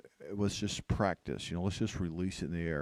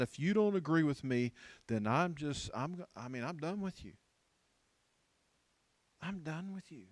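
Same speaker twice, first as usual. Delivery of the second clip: higher-pitched, fed-up imitation; mock dismissive; 6 semitones higher than usual. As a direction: act out writing someone off. Imitating an unforgiving younger generation.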